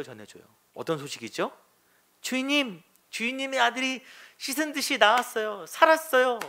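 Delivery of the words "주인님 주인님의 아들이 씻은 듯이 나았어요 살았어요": high, eager good news; excited servants. Retold or imitated. Servants meeting their master with the news, high and eager. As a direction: burst out with joy to the master.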